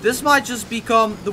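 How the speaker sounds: usual speaking voice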